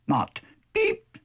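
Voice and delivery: squeaky high peep; mock chick peep. Duck mocking the chick by squeaking a high peep. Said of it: "you'd say quack".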